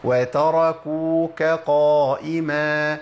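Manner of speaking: slow chant, وتركوك with a clear light k before قائما; drawn-out model recitation, k and q kept apart in وتركوك قائما; correct light kaf. Shown as a demonstration. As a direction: recite وتركوك قائما keeping the kaf thin, then a heavy qaf. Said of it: "مش ققا".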